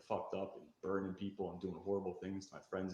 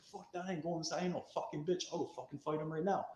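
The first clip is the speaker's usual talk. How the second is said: higher, heated tough talk; retold defiance; 7 semitones higher than usual. Storyteller refusing to go inside, mild heat. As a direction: snap back at the friends.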